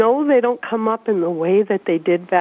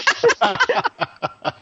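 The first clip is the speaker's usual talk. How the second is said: high laugh. Real, not acted. Laughs at own blunder.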